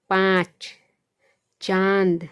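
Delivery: पाँच, चांद loud, vowel through mouth and nose together; nasalised anunasik vowel. Shown as a demonstration. Teacher showing how anunasik is pronounced, पाँच, चांद loud and nasalised. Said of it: "तो कैसे उसका उच्चारण होता है".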